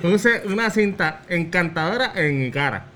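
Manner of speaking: blurred lip consonants; garbled mouth-game speech. Real, not acted. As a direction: say it without letting the lips touch. Mouth game, partner guesses distorted phrase.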